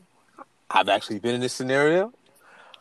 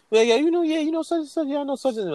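high mock friend; 10 semitones higher than usual. Voicing the awkward friend.

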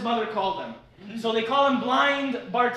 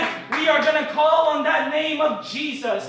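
loud, rising revival preaching; preaching at full force; 3 semitones higher, 4 dB louder than usual. Acted. Preacher rousing the congregation, full-force preaching. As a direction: proclaim it over the crowd.

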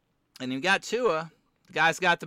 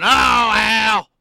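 high, very loud drawn-out wail; exaggerated broadcaster impersonation, shouted high and long; Herbstreit impression; 6 semitones higher, 12 dB louder than usual. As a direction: cry it out as a booming, hammed-up impersonation. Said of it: "my Herbstreit's a lot like my Micah impression".

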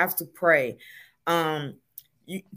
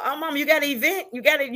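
put-on quick, high voice of the grown kids teasing mom; 7 semitones higher, faster than usual. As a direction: toss it off quick and high like her children ribbing her. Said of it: "my children say that".

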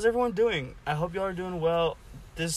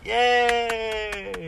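bright little cheer; excited cheer; 7 semitones higher, 5 dB louder than usual. Real, not acted. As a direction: cheer for your guest. Cheering as he introduces Zach.

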